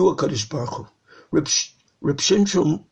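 normal talking voice

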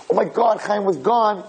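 high-pitched, urgent imitation of astonished parents; frantic amazement. Parents phone everybody about their revived son, frantic amazement.

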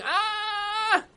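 long, high-pitched "ah" scream; storyteller's imitation of a desperate shout; acted scream. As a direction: cry out high and drawn-out, without full volume. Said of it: "he just started shouting, started screaming".

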